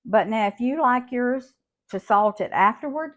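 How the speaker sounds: ordinary talk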